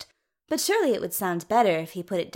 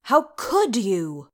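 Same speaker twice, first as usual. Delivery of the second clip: loud, slow, aggrieved accusation; outraged; 5 dB louder, slower than usual. A narrator's reading. Thorin confronts his sister over the stone, outraged.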